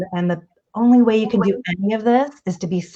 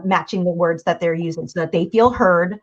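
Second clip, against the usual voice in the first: ordinary talk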